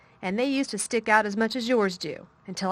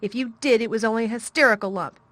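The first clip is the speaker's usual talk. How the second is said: loud, fierce scolding; girl fiercely contradicting the boy; fierce contradiction; 2 semitones higher, 7 dB louder than usual. A narrator's reading. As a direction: flatly contradict him. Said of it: "contradicted Mary fiercely".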